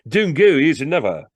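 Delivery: loud, high, pressing salesman; urgent sales patter. Acted. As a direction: call the customer back eagerly. Salesman holding on to customer.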